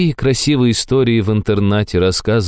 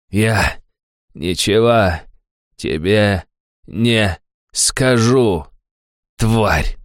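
defiant, word by word; 3 semitones higher, much slower than usual. Acted. Villain refuses, spitting defiance.

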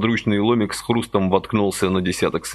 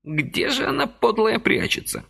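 muttering. A narrator's reading. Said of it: "бормотал он".

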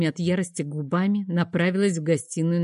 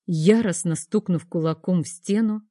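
usual speaking voice

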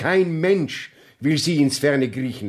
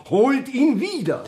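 high, loud urgent call; 11 semitones higher, 6 dB louder than usual. Acted. Walter calls out loudly for Licht to fetch the judge back. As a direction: shout the order after him.

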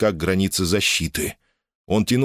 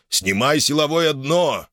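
slow, raised-pitch order through clenched teeth; through gritted teeth; 7 semitones higher, slower than usual. A narrator's reading. Metcalf ordering the force floor removed, through gritted teeth. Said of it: "сквозь зубы проговорил Меткалф".

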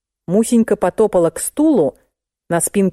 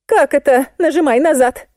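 higher-pitched, baffled question; puzzled; 8 semitones higher than usual. A narrator's reading. Client baffled by the order to press back, puzzled. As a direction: ask it not understanding at all. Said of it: "не поняла толстуха".

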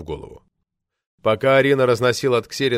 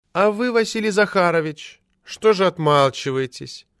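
reproachful; 8 semitones higher than usual. A narrator's reading. Secretary prodding a silent colleague, reproachful. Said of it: "упрекнул Т Т Застрехина".

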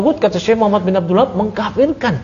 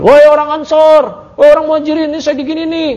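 calling out; 9 semitones higher than usual. Retold or imitated. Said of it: "satu sama lain manggil".

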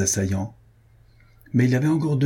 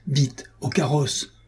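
higher-pitched, drawn-out call to hurry; urgent call; 5 semitones higher, slower than usual. A narrator's reading. Horseman ordering his valets to the coach, urgent restrained call. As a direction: call out the order without real volume. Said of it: "cria ce cavalier".

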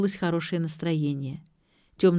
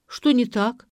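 higher, puzzled question with mild amazement; amazed; 6 semitones higher, 4 dB louder than usual. A narrator's reading. Escort questioned about his choice of show, amazed. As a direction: ask it taken aback. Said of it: "изумился кавалер".

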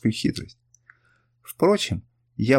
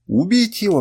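raised, louder voice of an outraged crowd; crowd cry; 8 semitones higher, 5 dB louder than usual. A narrator's reading. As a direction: cry out for blood, high and loud. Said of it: "вскричало несколько голосов". (гневный выкрик толпы)

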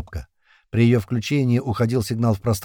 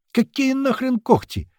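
indignant outburst; 14 semitones higher, slower than usual. A narrator's reading. Колючий scoffs at the claw theory, indignant outburst. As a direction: pitch leaps high, drawn-out disbelief. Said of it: "взвился Колючий".

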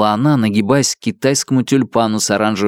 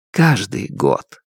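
tender; slower than usual. Acted. Repeated promise, falling.

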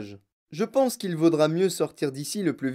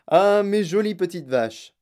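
slow, loud, higher cry of fondness; Monsieur Wonka exclaiming; 4 semitones higher, 6 dB louder than usual. A narrator's reading. Wonka greeting his cows, exclaiming adoringly. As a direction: exclaim it fondly, drawing it out. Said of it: "s'écria monsieur Wonka".

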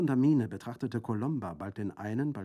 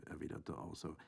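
usual speaking voice

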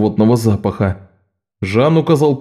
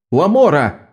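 shouting; 6 semitones higher, slower than usual. Acted. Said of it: "проорал Аньеис".

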